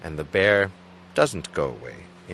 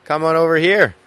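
loud, higher call across the camp; 8 semitones higher, 9 dB louder than usual. Retold or imitated. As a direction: shout over to the others at camp. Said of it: "call over and say".

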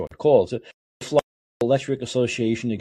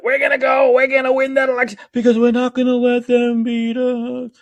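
high, loud possessed-politician rant; Donald Trump; 13 semitones higher, 6 dB louder than usual. Retold or imitated. Host mimics a politician taken over, high mock scream. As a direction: hold a hammed-up yell on one high note. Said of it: "like he's being taken over by another entity".

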